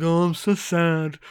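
slow, hammed-up mopey voice; heavy drawn-out oafish self-pity; mock sad lover. Riker after a breakup propositioning Wendy, mock sad and slow. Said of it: "post-weird breakup situation".

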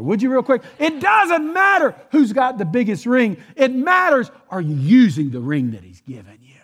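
loud, high-pitched sermon shout; impassioned preacher's shout; preaching at full force. Real, not acted.

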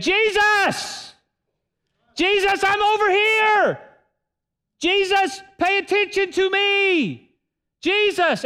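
very high, drawn-out calling across a crowd; desperate shout. Shown as a demonstration. Blind man calling out to Jesus, desperate shout. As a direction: yell for Jesus like your life depends on it. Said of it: "Let me tell you what happened".

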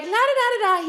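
high singsong la-da-da, acting oblivious; breezy pretend humming, no idea what's coming; mock carefree la-di-da. Friends strolling the haunted house, mock carefree singsong.

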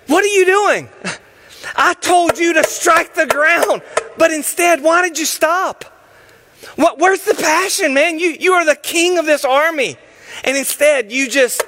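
angry shout. Acted. Prophet shouting at the king for stopping. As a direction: demand where the passion went.

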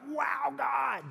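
high, amazed exclamation; awed wow. Retold or imitated. Believer overwhelmed by God's blessings, amazed high exclamation. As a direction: gasp out in wonder at the blessings. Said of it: "you're just like".

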